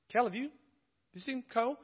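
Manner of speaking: asking his wife lightly, not worried yet; casual retold question.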